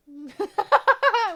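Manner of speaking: real burst of high, loud laughter. Real, not acted. Streamer who just started, amused by viewers, loud laugh. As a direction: crack up loudly, high in pitch.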